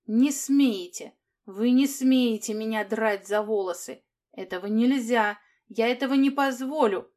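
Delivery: loud, hysterical trembling cry; hysterical shout. Acted. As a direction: shout it near breaking, hysterical. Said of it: "истерическими нотками крикнул дрожащий и рвущийся молодой голос".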